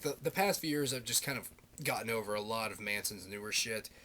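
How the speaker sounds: ordinary talk, nothing marked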